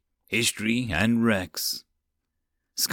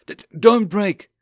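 high, loud stammer; nervous stammer; 8 semitones higher, 7 dB louder than usual. Acted. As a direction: stutter out a plea.

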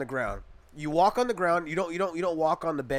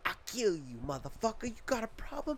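menacing threat; 4 semitones higher, 11 dB quieter than usual. Retold or imitated. Bully threatens narrator.

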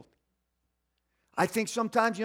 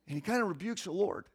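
ordinary talk

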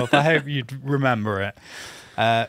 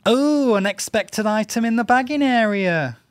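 high, loud squealed checkout voice; self checkout prompts; 11 semitones higher, 7 dB louder than usual. Pretend. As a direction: shriek the checkout line up high. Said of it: "Let's, let's all do a voice".

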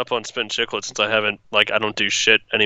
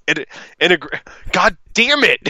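stumbling over a word; 4 semitones higher than usual. Retold or imitated. Imitating podcast host failing at "integration", stumbling then exasperated. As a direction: stammer the word, then burst out cursing. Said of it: "him trying to say integration".

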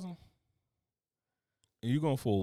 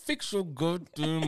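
slow words squeezed through clenched teeth; talking through teeth; 3 semitones higher, 4 dB quieter, much slower than usual. Pretend. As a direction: talk through gritted teeth, slowly. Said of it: "I'm talking through my teeth".

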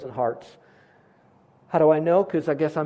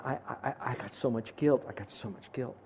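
hushed, understated imitation of a sobbing woman; low, quiet echo of someone's tearful confession; retold sobbing; 3 semitones lower, 10 dB quieter than usual. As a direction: retell her sobbing softly, faltering at the start and fading away. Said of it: "And she's sobbing".

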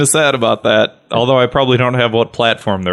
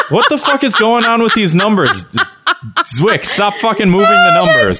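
higher-pitched comic exasperation; exasperated; 6 semitones higher than usual. Real, not acted. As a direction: complain in disbelief, pitch raised. Annoyed at moving numbers while editing.

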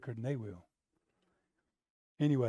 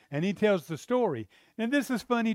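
ordinary talk, nothing marked

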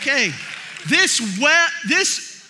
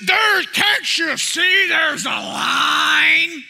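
drawn-out, higher, indignant holler; crowd-rousing shout; 4 semitones higher, 3 dB louder, much slower than usual. Retold or imitated. Older man rallying the line against a cutter, indignant shout. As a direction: holler it slowly to rile up the crowd. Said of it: "This guy begins to build his army".

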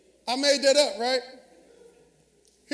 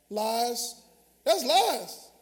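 ordinary talk, nothing marked

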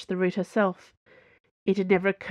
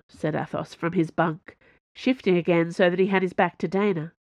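plain reading voice

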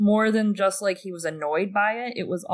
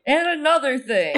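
mock indignant; 6 semitones higher, 5 dB louder than usual. Mock outraged rant catchphrase.

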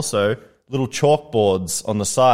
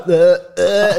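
mock gagging; 8 semitones higher, 7 dB louder than usual. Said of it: "she's like".

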